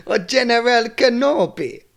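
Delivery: loud hammed-up Jamaican accent; mock Jamaican accent. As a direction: belt it in a half-Jamaican cartoon voice. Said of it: "I'm talking Jamaican now or something else".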